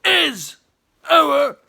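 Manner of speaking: loud, high furious shout; furious demand. A narrator's reading. Hungry chief shouts at the boy, furious. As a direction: roar the demand, rising with each word.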